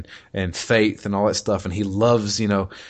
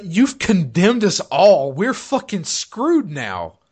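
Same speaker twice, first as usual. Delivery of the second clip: high, louder, slow despairing cry; panicked outcry; 9 semitones higher, 5 dB louder, much slower than usual. Retold or imitated. First Enchanter crying out at the disaster, despairing. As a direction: wail that all is lost. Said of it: "He's like".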